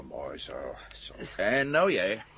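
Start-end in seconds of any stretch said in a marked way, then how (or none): 0.0-1.1 gefluisterd. Acted. Satisfied tying up.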